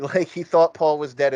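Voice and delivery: ordinary delivery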